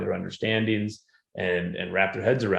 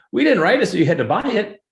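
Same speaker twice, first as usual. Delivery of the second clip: much higher and louder, urgent reassurance; retold pleading that she need not pay; insistent; 13 semitones higher, 7 dB louder than usual. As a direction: hurry to set the student straight, voice up high. Retelling reassurance to a student.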